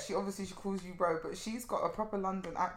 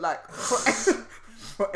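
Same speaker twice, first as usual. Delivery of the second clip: usual speaking voice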